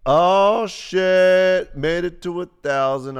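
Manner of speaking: loud, high, drawn-out cheer; excited celebration. Real, not acted. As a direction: hoot at hitting the milestone, stretching the first words. Stream viewers reach a thousand.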